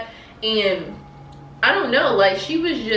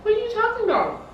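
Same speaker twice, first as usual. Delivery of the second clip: much higher, innocent denial; coworker playing dumb; 13 semitones higher, faster than usual. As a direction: play innocent in a high voice.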